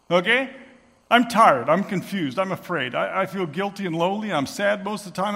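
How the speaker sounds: unmasked man confessing to the room; raw confession. Retold or imitated. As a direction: pour it all out to the room. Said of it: "I throw off my mask".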